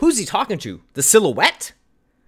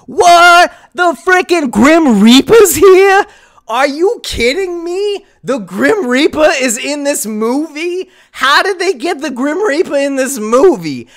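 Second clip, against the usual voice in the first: mock disbelief; 6 semitones higher, 3 dB louder than usual. Real, not acted. Mock outrage at script.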